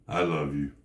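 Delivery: low, toneless throwaway; insincere. Shown as a demonstration. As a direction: say it as if you don't mean it. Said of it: "What if I went".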